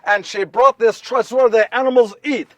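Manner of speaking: normal talking voice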